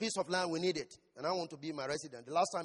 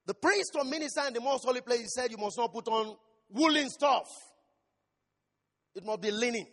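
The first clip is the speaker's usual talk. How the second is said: louder, higher preaching drive; forceful preaching; 5 semitones higher, 8 dB louder than usual. Acted. Preacher driving home the priestly rule, forceful. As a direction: press the rule home to the congregation.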